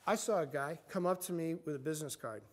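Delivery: ordinary talk, nothing marked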